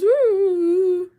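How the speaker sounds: high, loud mournful dog howl; mock puppy whine. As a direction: cry like a lonely little dog. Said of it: "She goes".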